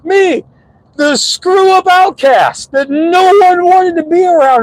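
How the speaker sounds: high, drawn-out squeal of disbelief; very high-pitched, slow incredulity; incredulous. Real, not acted. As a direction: stretch it out way up high, amazed. Incredulous aside about being asked advice.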